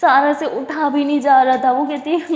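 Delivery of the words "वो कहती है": plain reading voice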